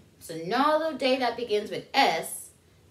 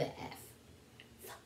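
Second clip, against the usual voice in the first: slow whispered clue; whispered hint; 21 dB quieter than usual. Acted. Teacher giving kids a secret clue, whispered.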